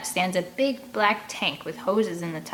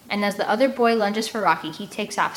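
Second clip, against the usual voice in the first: plain reading voice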